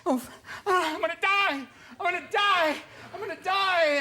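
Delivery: high, loud panicky wail; mock panic. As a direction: shriek it like you're dying. Retold coke panic.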